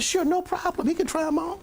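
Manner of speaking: obliging manager. Retold or imitated. Manager agrees, voiced in story.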